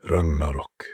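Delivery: ordinary delivery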